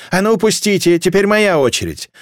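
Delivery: pushy demand. A narrator's reading. Woman demanding her turn in the crowd, pushy. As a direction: demand to be let through.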